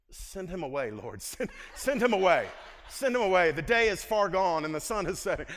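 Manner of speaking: hammed-up panic, begging the Lord loudly; laughing mock protest. Preacher recalling his reaction to tithing, loud laughing mock panic. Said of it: "And I'm thinking".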